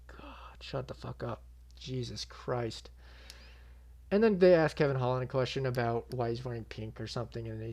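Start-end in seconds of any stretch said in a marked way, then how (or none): 0.1-2.9 hushed, weary aside, starting in a whisper; muttered exasperation. Retold or imitated. Viewer fed up with the insults, muttered exasperation. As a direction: mutter it under your breath, fed up. His muttered reaction to the fighters.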